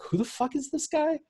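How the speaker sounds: mock puzzled fan. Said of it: "who watches nascar is like".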